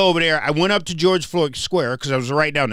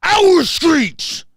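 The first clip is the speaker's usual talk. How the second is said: much higher, much louder shouted chant reply; full-throated angry protest answer, higher and louder; angry crowd shout; 13 semitones higher, 6 dB louder than usual. Retold or imitated. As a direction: roar it back like the whole furious crowd. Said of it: "And everybody's like".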